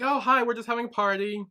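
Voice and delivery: high-pitched, mock-innocent hello; innocent greeting. Acted innocent greeting to police.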